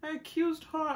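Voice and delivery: surprised realization. Retold or imitated. Mother realizing she wrongly blamed daughter, surprised. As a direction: catch yourself, then apologize. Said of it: "I'm like".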